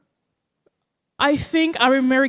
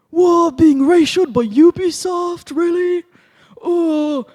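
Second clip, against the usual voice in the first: high, loud, drawn-out disbelief; mock astonishment; 8 semitones higher, 6 dB louder, slower than usual. Streamer mimicking comments about being ratioed, mock astonishment. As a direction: exclaim it slowly, pitched up in mock shock.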